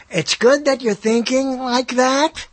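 slow, lightly voiced praising teacher; old teacher's praise. Old schoolteacher praising a pupil, slow and lightly mimicked. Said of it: "That's the way my teachers talked".

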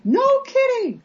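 very high, stretched exclamation; retold amazement. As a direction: re-enact being floored, pitch way up. Retells own amazed reply.

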